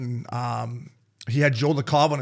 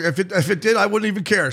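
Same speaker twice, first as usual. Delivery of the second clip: hungover bravado; 6 semitones higher, 4 dB louder than usual. Retold or imitated. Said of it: "but I'm like".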